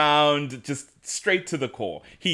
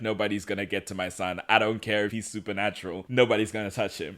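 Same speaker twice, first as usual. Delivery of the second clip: low, quick, quiet mama bear; protective mama bear; 5 semitones lower, 7 dB quieter, faster than usual. Retold or imitated. Mother shielding her supernatural son, understated mama bear. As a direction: keep it low and fast, lightly protective. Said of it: "being mama bear a little bit".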